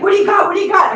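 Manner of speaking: loud, high, excited demand; imitating a nosy pet. Voicing Amber freaking out about a phone, mock-eager demand. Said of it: "She's like".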